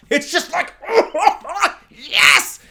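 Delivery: high, loud burst of delight; overjoyed laughing cheer. Real, not acted. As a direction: squeal with laughter into a triumphant yes. Overjoyed at favourite film moment.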